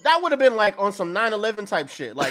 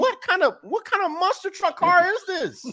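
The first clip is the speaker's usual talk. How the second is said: high-pitched incredulous question; baffled disbelief; 9 semitones higher than usual. Real, not acted. Baffled rant about the truck.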